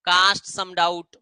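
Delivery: very slow and deliberate; teacher giving an example phrase; slow phrase demo. Shown as a demonstration. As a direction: lay out cast some doubt slowly. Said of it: "ठीक है".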